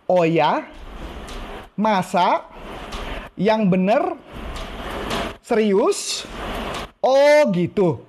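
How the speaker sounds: rising, wide-eyed amazement, last phrase slow and drawn; interested surprise. Shown as a demonstration. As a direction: show keen interest phrase by phrase. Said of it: "Ulang lagi ya".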